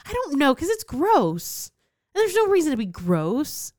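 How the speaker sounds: high, exasperated quoted rant. Retold or imitated. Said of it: "I'm like".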